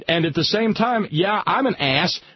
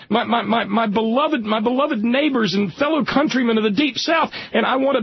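normal talking voice

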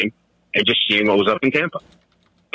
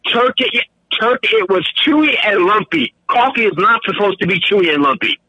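excited rant; 8 semitones higher than usual. Real, not acted. Ranting about lumpy coffee.

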